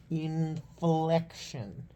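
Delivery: "Inflection" stretched very slowly, syllable by syllable; drawn-out word. Shown as a demonstration. Mulling over how to say inflection, drawn out slowly. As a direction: softly draw out each part of "Inflection".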